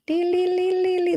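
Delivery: high-pitched trilled lee-lee-lee; rapid high "lee-lee-lee" filler noise; high scrolling noise. Real, not acted. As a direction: make a high sing-song scrolling sound. Imitated noise while scrolling long contract.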